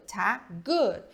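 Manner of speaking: ordinary delivery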